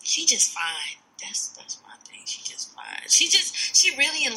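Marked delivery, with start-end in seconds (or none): 1.2-2.8 unvoiced hushed aside; whisper. Real, not acted. Friend whispering her honest take on the TV couple. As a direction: whisper it like a secret.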